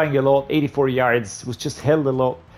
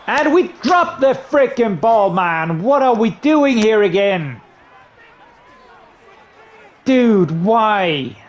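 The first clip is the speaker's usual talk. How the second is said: louder and much higher, exasperated; real gamer anger after a fumble; frustrated outburst; 7 semitones higher, 5 dB louder, slower than usual. Real, not acted. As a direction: throw the words at the dropped ball. Fumble, player erupts in frustration.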